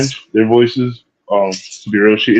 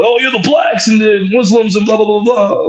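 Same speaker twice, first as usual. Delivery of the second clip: high-pitched mocking whine of a complainer; mock complaining; 10 semitones higher than usual. Speaker mimics people complaining about blacks and Muslims, mock complaining. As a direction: imitate their griping in a much higher voice. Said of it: "complain about".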